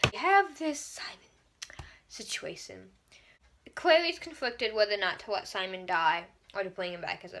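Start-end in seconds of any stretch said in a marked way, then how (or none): 0.1-2.9 slow dramatic whisper. Acted. Vlogger teasing the Simon plot to viewers in a slow whisper. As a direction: hush it like a secret. Conspiratorial aside about the plot.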